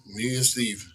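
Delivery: ordinary talk, nothing marked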